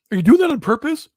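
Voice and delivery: dad asking son about his voice, mock disbelief; mock incredulous.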